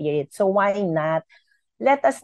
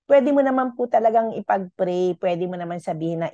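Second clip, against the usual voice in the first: ordinary talk, nothing marked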